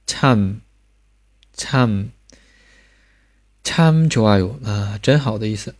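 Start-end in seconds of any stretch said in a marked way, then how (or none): 0.0-2.1 "참" said as cham with a hummed ending; isolated, careful "참", falling tone; final m demonstration. Shown as a demonstration. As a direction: puff the ch, close lips on the m of "참". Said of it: "下面加收音".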